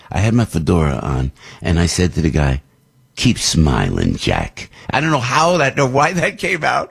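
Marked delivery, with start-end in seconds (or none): 3.2-4.7 very low, slow tough-guy impression; slow, deep movie tough guy; Clint Eastwood. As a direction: drop way down and drag it out. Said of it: "I did the Clint Eastwood line".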